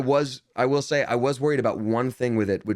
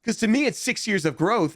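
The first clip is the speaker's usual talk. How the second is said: ordinary talk, nothing marked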